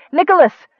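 high, loud call of "Nicholas"; startled call. A narrator's reading. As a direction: call out to him suddenly. Sister turns at brother's voice, calls.

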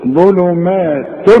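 ظلمات drawn out slowly; slow recitation. Shown as a demonstration. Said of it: "شوف ظلمات". (ظلمات ببطء)

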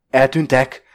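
loud, sarcastic echo; mocking question. A narrator's reading. Blavatsky repeats her word, questioning and mocking. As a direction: throw the word back loudly, in disbelief. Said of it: "Ismétli Blavatsky kérdőn és gunyorosan".

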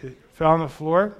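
ordinary talk, nothing marked

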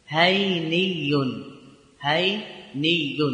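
each sound stretched out; slow demonstration. Shown as a demonstration. Teacher showing the form to students, drawn out slowly. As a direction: say it slowly so students can copy. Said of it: "فقيل".

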